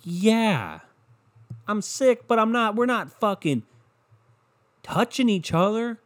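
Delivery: high, slow, drawn-out exasperation; hammed-up pleading defensiveness; mock indignant retort. Sick student retorting to a horrified project partner, exasperated.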